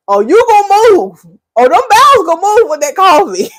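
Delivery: high, loud, laughing exclamation; cracking up. Real, not acted. Hyped up telling viewers about coffee, loud and high. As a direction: burst out with the joke half-laughing.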